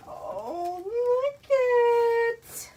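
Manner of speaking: high, drawn-out delighted squeal; thrilled high-pitched reaction; excited squeal. Real, not acted. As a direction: coo it high and loud, stretched out. Excited reaction on opening the box.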